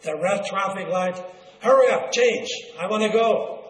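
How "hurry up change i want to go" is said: loud, higher, impatient urging. Acted. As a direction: hurry the red light along like a waiting driver. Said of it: "We don't like to wait".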